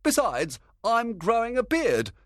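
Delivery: high-pitched indignant grumble; complaining. A narrator's reading. Flute refusing a woman's part because of his beard, complaining. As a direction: protest in a high character voice. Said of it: "Flute complained".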